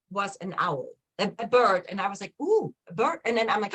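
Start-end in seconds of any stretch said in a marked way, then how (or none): 2.4-3.2 higher, lightly mock-delighted coo; retold delight. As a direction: play back the moment she noticed the bird. Retold spotting a bird while driving.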